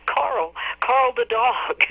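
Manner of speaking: high, slow drawn-out mock wail; imitating a tearful wail. Friend wailing the dog's name, high and slow. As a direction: stretch the name out like a weepy friend. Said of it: "my friend called me just sobbing".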